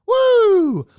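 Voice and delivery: high, loud cheer of delight; excited whoop. Real, not acted. Cheering his pick of David Johnson, excited whoop. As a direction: let out a real victory whoop.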